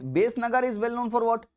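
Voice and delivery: usual speaking voice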